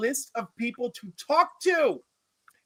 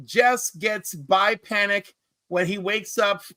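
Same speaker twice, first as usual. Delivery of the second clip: usual speaking voice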